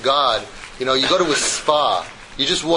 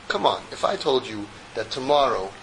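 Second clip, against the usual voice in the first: ordinary delivery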